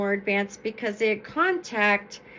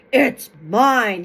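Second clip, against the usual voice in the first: calling out; 9 dB louder than usual. Acted. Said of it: "he called to his nephew".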